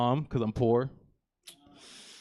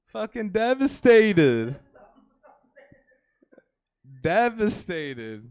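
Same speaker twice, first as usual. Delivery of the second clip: slow drawn-out mock grief; mock devastated; 4 semitones higher, 5 dB louder, much slower than usual. Comedian mocking his own grief, slow. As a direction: stretch it out in fake despair.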